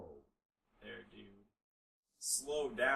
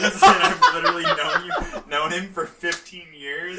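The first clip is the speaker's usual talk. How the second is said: laughing hard mid-sentence; words bursting out through laughter; 4 semitones higher, 15 dB louder than usual. Real, not acted. Retold answer dissolving into laughter.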